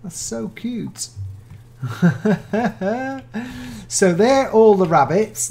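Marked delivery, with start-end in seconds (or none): 1.1-3.8 high, giggling laugh; delighted laugh. Real, not acted. YouTuber charmed by a rabbit's tail, high delighted laugh. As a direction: laugh at how cute the tail is.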